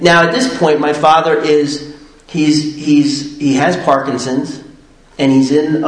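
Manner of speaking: usual speaking voice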